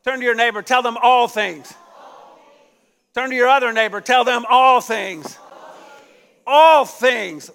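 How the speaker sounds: loud rising call to repeat; congregation call-out. Real, not acted. Preacher urging the congregation to tell their neighbors, loud. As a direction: push the church to shout it back. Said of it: "Somebody say".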